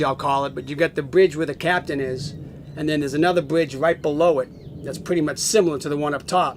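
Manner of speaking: ordinary talk, nothing marked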